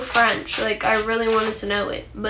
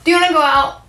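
talking to the dog; 4 semitones higher, 5 dB louder, much faster than usual. Real, not acted.

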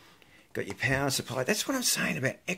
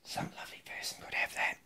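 soft teasing whisper; 11 dB quieter than usual. Real, not acted. Teasing viewers about the giveaway, whispered.

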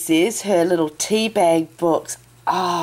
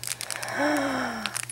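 breathy gasp; 6 semitones higher, 10 dB quieter than usual. Real, not acted. Sees pretty card.

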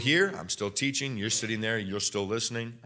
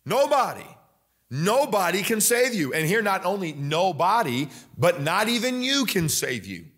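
higher, rising fervour through the list of names; preaching at full force; 5 semitones higher than usual. Acted. Preacher pressing the congregation that nobody else saves, full force. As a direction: preach it, building to the cry of nobody. Builds a fiery roll call.

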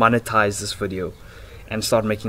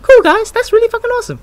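very high mock falsetto; exaggerated softer voice; 9 dB louder than usual. Shown as a demonstration. Vlogger demonstrating his old softer voice, exaggerated falsetto. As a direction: squeak it out high and loud, overdone. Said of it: "like, an even softer voice".

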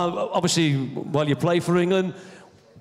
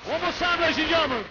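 announcer call; 10 semitones higher than usual. Real, not acted. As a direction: call the name out like a ring announcer. Host announces guest again.

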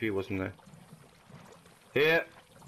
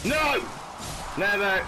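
defiant shout; 8 semitones higher, slower than usual. Real, not acted. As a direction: shout it back at the enemy. Shouting at game enemy.

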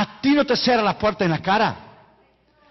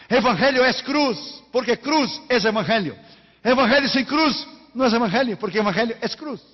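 fiery preaching; 6 semitones higher than usual. Acted. Preacher driving the point home to the congregation, fiery. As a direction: preach it out full force.

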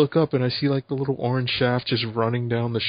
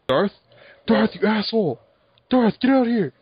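calling out; 7 semitones higher, 4 dB louder than usual. Real, not acted. Calling teammate in game.

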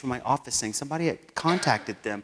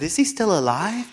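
eager, higher pitched ask; excited question; 7 semitones higher, 3 dB louder than usual. Retold or imitated.

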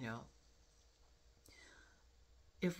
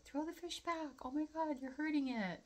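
frantic animal lover; 13 semitones higher than usual. Retold or imitated. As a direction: beg them to free the fish in a panicked little voice. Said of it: "I would be like".

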